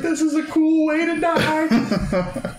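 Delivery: put-on 3 enjoy the doom like a happy kid; 1 calm delight. 4 retelling Fry, carried off, sounding slow, high and delighted. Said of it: "But he's not, like, screaming".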